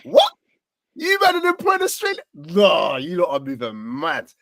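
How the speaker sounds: high, loud scoffing friend's voice; mocking disbelief. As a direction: scoff like a mate who can't believe it. Imagined friend mocking Australian manager.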